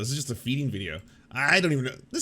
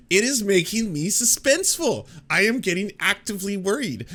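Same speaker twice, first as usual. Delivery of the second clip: hammed-up suspense, higher and louder; mock worried; 8 semitones higher, 5 dB louder than usual. Cohost retelling his reaction to horror violins, mock worried. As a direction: play at being spooked by the music. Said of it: "And I was like".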